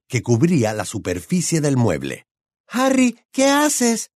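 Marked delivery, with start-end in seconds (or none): 2.7-4.1 high-pitched anxious call; Hermione. A narrator's reading. Girl shouts up from downstairs, high-pitched cry. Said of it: "gritó Hermione desde abajo".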